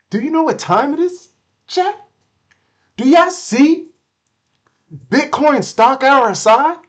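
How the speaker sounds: high, loud, breathless excitement over the chart; can't contain the thrill, voice shooting up; overexcited streamer yelling at chat; hyped up. Real, not acted. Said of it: "let me calm down".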